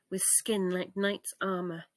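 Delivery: plain reading voice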